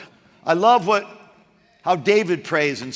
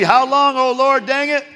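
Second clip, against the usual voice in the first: mock exasperated prayer; 5 semitones higher, 4 dB louder than usual. Preacher voicing exasperated psalmist.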